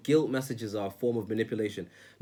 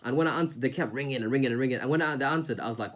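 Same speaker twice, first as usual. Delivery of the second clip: ordinary talk, nothing marked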